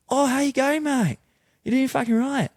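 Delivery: high, loud put-on Australian greeting; Australian accent. Backpacker keeping up his fake identity, Australian accent. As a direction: greet a mate in exaggerated Aussie. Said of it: "I started doing an Australian accent".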